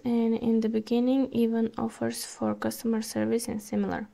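ordinary talk

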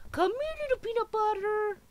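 sing-song pet voice. Real, not acted. Calling pet in baby voice.

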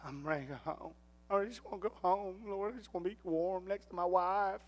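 soft, pleading, homesick; weary longing. Retold or imitated. Cold hunter praying to the Lord to go home, soft and weary. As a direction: sigh out a wish to go home. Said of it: "I thought".

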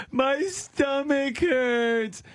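put-on high, slow whining child; whiny kid. Kid whining to his parents, high and drawn out. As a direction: drag it out like a sulky little kid.

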